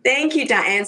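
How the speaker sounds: normal talking voice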